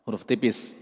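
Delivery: ordinary delivery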